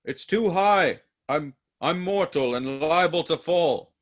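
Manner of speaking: scared of the drop; frightened protest. Acted.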